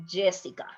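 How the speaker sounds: "Jessica" with a J/zh sound between sh and y; soft J. Shown as a demonstration. Teacher repeats the model for students, soft J pronunciation. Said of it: "something like in between".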